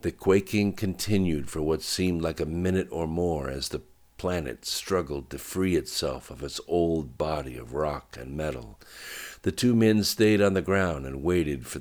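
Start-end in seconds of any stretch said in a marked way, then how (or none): none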